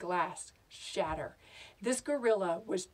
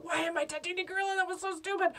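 high, rushed panic; frantic, high-pitched self-reproach; panicked thought; 8 semitones higher, faster than usual. Acted. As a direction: scold yourself in rising panic. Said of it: "I start to panic and think".

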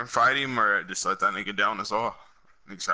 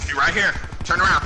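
loud urgent callout, higher; shouted callout; 7 semitones higher, 5 dB louder than usual. Real, not acted. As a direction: yell it to the team. Callout during firefight.

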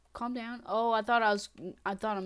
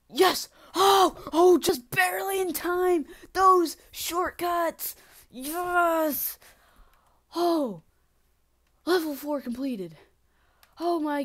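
high, loud, stretched-out elation; triumphant shout; 8 semitones higher, 11 dB louder, much slower than usual. Real, not acted. Player completes level four just in time, triumphant shouting. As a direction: yell in relieved victory.

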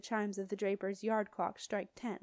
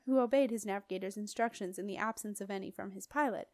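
ordinary delivery

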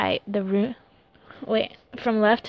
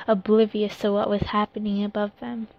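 ordinary delivery, nothing marked

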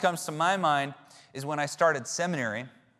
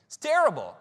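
normal talking voice

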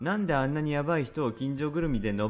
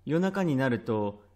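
plain reading voice

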